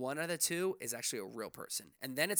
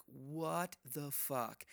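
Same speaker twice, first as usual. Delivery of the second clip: incredulous; 4 dB quieter, much slower than usual. Retold or imitated. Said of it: "And I'm like".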